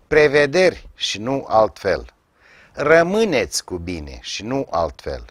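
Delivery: ordinary talk, nothing marked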